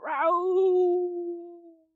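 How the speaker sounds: long, very high wolf howl; wolf robot howl. Acted. Green Wolf robot awakened by Stan, howling. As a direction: howl upward and hold it. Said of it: "awakens the Green Wolf robot".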